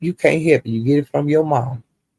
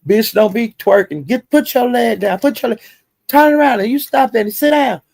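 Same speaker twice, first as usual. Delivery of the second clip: mock scolding, pitch rising higher; bossy scolding; 7 semitones higher than usual. Retelling the judge scolding her to stop twerking, higher and bossy. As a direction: scold her like a strict big sister. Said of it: "Judge Fifi was telling her".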